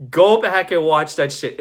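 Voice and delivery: ordinary delivery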